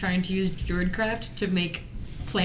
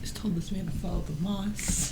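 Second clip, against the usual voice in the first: hushed, voiceless whisper; whispered aside; 8 dB quieter than usual. Real, not acted. Aghast player comments under breath.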